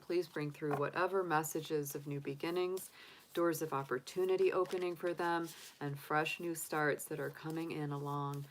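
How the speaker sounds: soft, hushed invocation; prayer. Acted. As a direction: call on the angels gently. Invokes angels before drawing cards.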